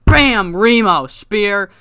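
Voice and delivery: loud, high burst on the hit; excited impact call. Acted. Narrator calls Remo's sudden spear, loud and excited. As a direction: call the surprise attack like a wrestling commentator. Sudden attack called out in recap.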